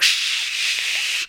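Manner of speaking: soft unvoiced long "shh".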